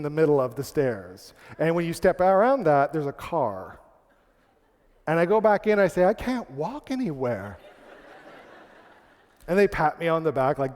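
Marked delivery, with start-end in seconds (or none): 6.0-7.6 slow, rueful admission; retold admission of defeat. Guest returning to the clerks, slow admission of defeat. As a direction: draw out the concession slowly.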